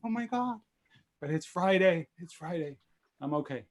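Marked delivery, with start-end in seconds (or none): 0.0-0.6 gasp, then high-pitched disbelief; shocked reaction. Retold or imitated. Retelling the mistaken date to colleagues, shocked. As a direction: re-enact the shock with a sharp gasp. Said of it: "You shocked me".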